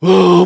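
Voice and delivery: loud, drawn-out mock wail; imitated bawling. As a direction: burst suddenly into an imitated cry. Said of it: "막 우는 거예요". (갑자기 크게 우는 소리 흉내)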